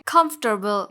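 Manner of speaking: कंफर्टेबल said KUMF-ter-bul; correct pronunciation. Shown as a demonstration. Teacher repeats the correction, O silent. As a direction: say कंफर्टेबल with no o after f. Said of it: "ओ का sound नहीं आएगा".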